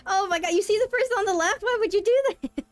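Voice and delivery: high, loud disbelieving cry; shocked outburst. Real, not acted. Streamer shocked at an opponent's discard, high and loud.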